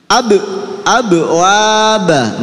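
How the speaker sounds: "ab" bounced on b, then slow, stretched "waba"; qalqalah. Shown as a demonstration. Teacher leads learners in a qalqalah drill, bouncing "ab". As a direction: bounce the b of "ab", chant "waba" long. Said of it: "Nggih, qalqalah".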